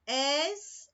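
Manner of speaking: "Es" said slowly as a drawn-out hissing S, twice; isolated sound. Shown as a demonstration. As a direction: stretch the "Es" into a clear S sound. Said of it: "Es, som de S". (destacar o som "Es" como um S sibilante)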